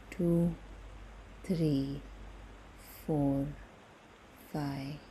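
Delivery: calm, unhurried meditation voice with long pauses; guided meditation. Acted. Guide leading listeners through breathing before bed, calm meditation voice. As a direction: ease them toward sleep, leaving space after each phrase.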